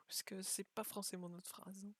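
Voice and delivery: a quick whispered aside to the viewers. Real, not acted. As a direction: whisper it under your breath as a side remark. Aside while reading quiz.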